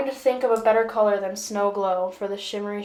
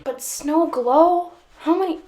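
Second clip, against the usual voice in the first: exasperated disbelief; 6 semitones higher, 5 dB louder than usual. Real, not acted. Exasperated rant at shade name.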